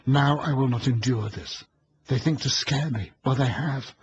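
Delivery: defiant resolve. A narrator's reading. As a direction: gather courage into a fierce vow. Eleanor steels herself to scream.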